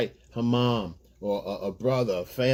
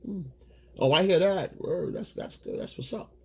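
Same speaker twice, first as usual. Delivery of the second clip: higher and softer, trailing off to a near whisper; mock indifferent agreement; 8 semitones higher, 4 dB quieter than usual. Letting her vent, answering with bored okays. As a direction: nod along with no real interest. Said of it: "listen and go".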